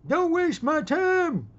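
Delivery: high, drawn-out yell; furious shout. Acted. Old man warns a young punk off, shouting furiously. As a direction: roar it slowly at full force. Acted outburst at time-waster.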